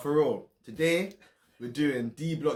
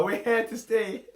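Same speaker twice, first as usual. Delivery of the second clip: hyped intro; 9 semitones higher, 4 dB louder than usual. Acted. Intro catchphrase, high and loud.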